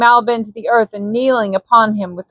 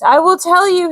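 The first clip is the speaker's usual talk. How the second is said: high, hurried plea; frightened cry; 9 semitones higher than usual. A narrator's reading. Malbin cries out to angry Korak, frightened. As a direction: give in out of fear. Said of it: "he cried".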